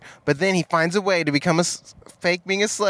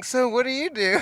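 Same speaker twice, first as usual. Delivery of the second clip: speed dating voice; 8 semitones higher than usual. Pretend. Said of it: "speed dating".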